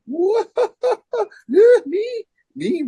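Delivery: very high, cracking, half-laughing; giddy disbelief. Retold or imitated. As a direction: squeak out that it can't be you. Disbelief at opening for Sabbath.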